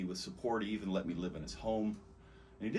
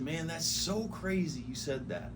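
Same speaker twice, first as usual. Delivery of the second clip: amazed; 7 semitones higher, slower than usual. Retold or imitated. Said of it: "I said".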